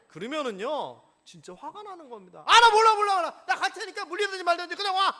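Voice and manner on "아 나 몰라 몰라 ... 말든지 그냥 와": higher, louder exasperated yelling; mock fed-up outburst. Fed-up husband yells at his wife to just come. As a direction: give up on her loudly.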